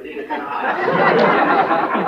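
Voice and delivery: high, loud, slow, laughing through it; laughing punchline. Real, not acted. Quoting the French colonel to the audience, laughing.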